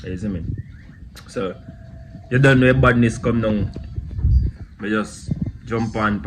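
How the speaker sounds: ordinary talk, nothing marked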